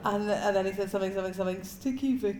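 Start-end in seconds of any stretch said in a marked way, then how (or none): 1.6-2.4 higher put-on Birmingham voice; Birmingham accent. Man heckling the comedian mid-set, mock Birmingham accent. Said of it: "just trying to be the accent".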